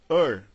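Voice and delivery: ER said as English 'ur'; English ER sound. Shown as a demonstration. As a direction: say er as one central r-coloured vowel. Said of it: "ele dá o som".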